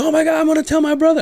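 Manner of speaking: recognise a friend and squeal up high; excited high voice. Retold or imitated. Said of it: "Doesn't he have the high voice?".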